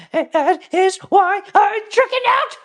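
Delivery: high mocking falsetto; squeaky impression of a woman; mock Erica Kirk.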